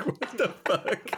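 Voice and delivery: mock shock. Reacting to the whispered threat.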